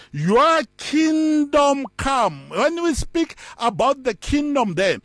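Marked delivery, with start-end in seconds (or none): 0.1-2.5 very slow, drawn-out reading; solemn scripture quote. Shown as a demonstration. Preacher quoting the prayer to the church, very slow and solemn. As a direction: intone "Your kingdom come" slowly. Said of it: "it says".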